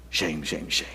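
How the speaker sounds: ordinary talk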